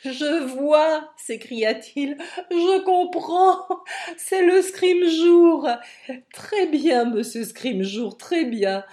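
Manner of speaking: high, slow mocking laugh through the words; old man laughing scornfully at the young man; loud laughter. A narrator's reading. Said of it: "se mit à rire bruyamment".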